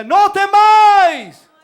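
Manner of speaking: grito agudo a todo volumen, vocales alargadas; Jesús gritando. Acted. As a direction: lanza un llamado atronador y sostenido. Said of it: "Él les gritó". (grito potente y prolongado)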